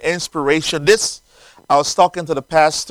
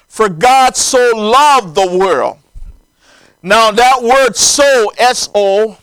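raised, emphatic preacher's delivery; preaching; 8 semitones higher, 8 dB louder than usual. Acted. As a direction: preach it out with ringing, drawn-out phrase endings. Preaches at full force.

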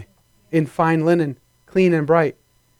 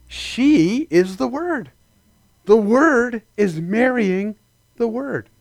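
slow preaching; 5 semitones higher, slower than usual. Acted. Preaching emphasis.

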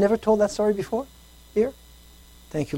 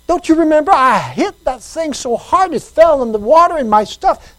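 louder, higher pleading voice; distressed man; 10 semitones higher, 7 dB louder than usual. Retold or imitated. Man begging God to remember his sin, distressed pleading. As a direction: press the Lord, upset and insistent.

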